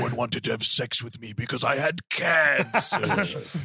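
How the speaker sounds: lightly put-on villain, slow and drawn out; slow, deliberate villain confession, played for laughs; mock villain voice. Podcaster jokes as Jigsaw about his cancer, slow mock villain. Plays Jigsaw explaining himself.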